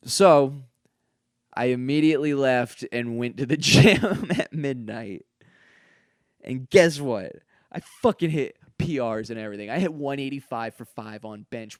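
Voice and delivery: loud, pumped-up boast about the gym; loud and proud gym brag; excited bragging. Real, not acted. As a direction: hype it up like you just crushed a workout. Bragging about midnight gym PRs.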